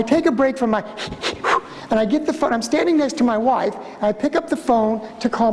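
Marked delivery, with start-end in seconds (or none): none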